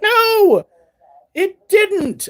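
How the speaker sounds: high, loud flat denial; a high-pitched brother saying no; brother denying. Retold or imitated.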